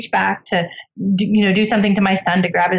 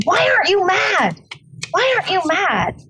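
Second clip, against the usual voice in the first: very high, exasperated mock demand; upset husband; 10 semitones higher than usual. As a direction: imitate a husband baffled and indignant, pitch soaring. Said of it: "He's upset".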